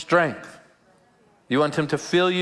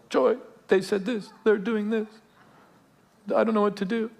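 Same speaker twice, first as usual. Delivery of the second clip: high-pitched imitation of himself griping; mock complaining; 7 semitones higher than usual. Pastor retelling his gripe to an elderly mentor, high mock complaining.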